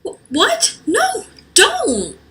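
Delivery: high, alarmed squeak; shocked protest; high startled no; panicked surprise. Acted. Panicked reaction.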